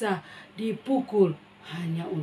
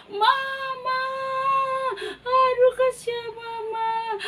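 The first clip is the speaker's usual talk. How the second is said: high, loud sung wail; keen over the dead mother; 6 dB louder than usual. Acted. Child weeping over mama. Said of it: "dia menangis".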